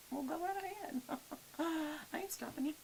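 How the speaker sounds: high, breathy teasing reply fading to a whisper; sassy comeback. Pretend. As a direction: brush them off with playful sass. Sassy reply to imagined viewer.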